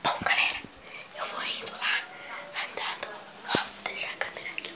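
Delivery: hushed whisper while sneaking. Real, not acted. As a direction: whisper so mom can't hear. Sneaking to mother, camera secret.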